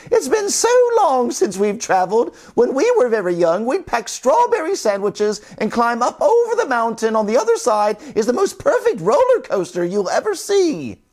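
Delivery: put-on high old-lady voice; sisters reminiscing. Sisters remembering their travels, high voice. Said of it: "they said".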